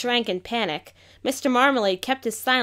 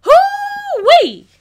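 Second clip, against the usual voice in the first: much higher, loud whoop; joyful whoop; 11 dB louder than usual. Acted. Whole crowd cheering together, loud high whoop. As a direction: holler it out with joy. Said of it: "let out a single word".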